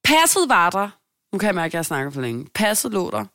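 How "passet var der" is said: triumph. Real, not acted. Triumphant reveal of passport.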